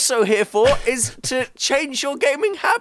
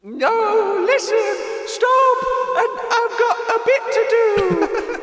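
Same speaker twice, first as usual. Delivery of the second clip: frantic high pleading; 3 dB louder, slower than usual. Acted. Ghost pleads, laughing.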